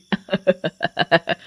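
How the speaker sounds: rapid, stuttering evil cackle; witch cackle. Acted. Witch laughs at the headman's door, menacing cackle. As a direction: cackle in a quick run.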